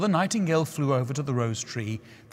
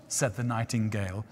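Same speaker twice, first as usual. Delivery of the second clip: usual speaking voice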